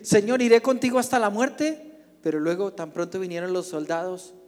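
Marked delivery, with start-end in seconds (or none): 0.0-2.0 loud, high declaration of loyalty; bold vow. Acted. Peter swears loyalty to Jesus, loud and high. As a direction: proclaim it like a reckless oath.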